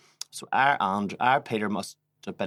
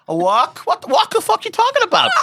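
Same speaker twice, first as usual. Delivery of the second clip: high, loud disbelieving shriek; outraged squeal of disbelief; incredulous; 7 dB louder than usual. Real, not acted. Telling her friend about the stolen wok, shrieking in disbelief.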